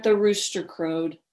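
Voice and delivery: ordinary talk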